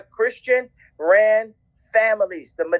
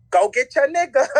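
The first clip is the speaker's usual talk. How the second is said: higher-pitched mock command; raised-pitch quoted order; retold urging; 6 semitones higher than usual. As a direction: imitate them urging someone to step in. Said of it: "they were like".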